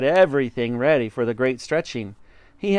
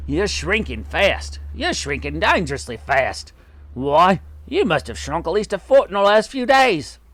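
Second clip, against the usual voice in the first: lightly voiced needling husband; taunting husband; 5 semitones higher than usual. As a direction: needle her, stretching out the warning. Voices Mr Twit taunting wife.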